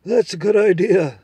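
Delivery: put-on a confident horse making its case to the boy; talking horse. Horse agrees.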